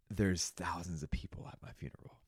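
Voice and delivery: whispered, barely voiced, imagining his funeral; soft awed whisper; hushed whisper. Real, not acted. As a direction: breathe it like a secret.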